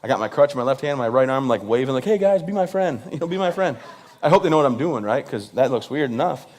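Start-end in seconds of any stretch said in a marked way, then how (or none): 2.0-3.8 higher-pitched friendly plea to fans; calling to crowd. Retold or imitated. Comic waving to twenty thousand people, higher-pitched calling out. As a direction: call out high, waving to a crowd.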